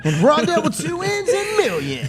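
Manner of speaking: high, laughing announcer voice. Acted. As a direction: keep the announcer read going, cracking up.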